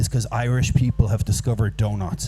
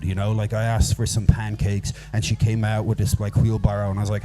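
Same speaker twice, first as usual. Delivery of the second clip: ordinary talk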